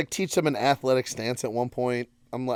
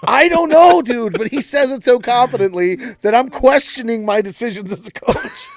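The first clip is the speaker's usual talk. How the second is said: laughing; 8 semitones higher, 8 dB louder than usual. Real, not acted. Laughing while retelling the confusion.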